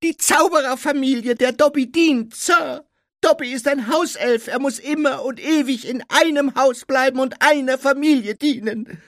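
loud, high-pitched squeaky elf; Dobby. A narrator's reading. House-elf explains his lifelong service, loud squeak.

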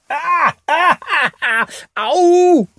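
high, loud hammed-up cries of pain; a boy fakes a cramp for his teammates, loud hammed-up yelps; faked cramp cries. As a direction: put on a show of a calf cramp. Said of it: "täuschte aber ... eine schlimme Verkrampfung in der Wade vor".

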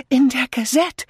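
high, loud and out of breath; gasping. A narrator's reading. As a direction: pant it out excitedly to a friend. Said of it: "keuchte sie". (keuchend, außer Atem)

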